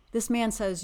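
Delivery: usual speaking voice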